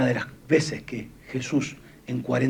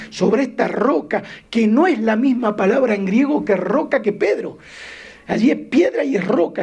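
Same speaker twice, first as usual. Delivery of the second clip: loud, high, impassioned preaching; preaching at full force; 8 semitones higher, 6 dB louder than usual. Acted. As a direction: preach it with fire to the congregation. (predicación a toda voz)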